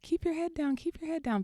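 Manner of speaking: high-pitched mock yell, no real volume; imitated hollering. Lift attendants to fallen child, mock hollering. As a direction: call it out urgently like the attendants. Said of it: "The attendants are hollering at him".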